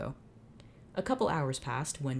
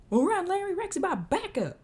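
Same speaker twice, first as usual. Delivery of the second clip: very high, loud cowboy yell; Jed voice retry; 4 dB louder than usual. Acted. Cowboy Jed yelling at Larry to run, second try. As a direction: holler the warning, full cowboy. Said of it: "can't I not do Jed".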